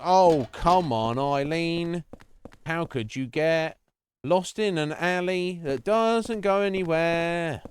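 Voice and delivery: high, slow, drawn-out mock scolding; mock exasperation. Streamer mock-scolds Eileen for getting lost, high and slow. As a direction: whine it out slowly as a fake complaint.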